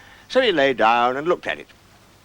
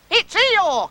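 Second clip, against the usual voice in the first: very high, loud squeal; high-pitched thrilled shout; terribly excited cry; 5 dB louder than usual. Acted. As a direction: shriek it out like an excited little one. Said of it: "cried Roo, terribly excited".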